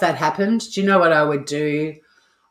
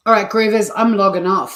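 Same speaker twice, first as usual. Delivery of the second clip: louder sign-off; 5 semitones higher, 6 dB louder than usual. Real, not acted. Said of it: "sign-off call".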